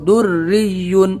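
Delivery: در ری یون with doubled r and firm double y. Shown as a demonstration. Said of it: "تھوڑا سختی لائیں گے یا کے اوپر". (در ری یون، تشدید کے ساتھ)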